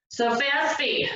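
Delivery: ordinary delivery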